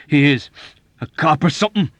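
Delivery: loud, high-pitched challenge; indignant demand. A narrator's reading. Sneezing airman asking about a stranger, indignant demand. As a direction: throw out the question in a huff. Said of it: "he demanded".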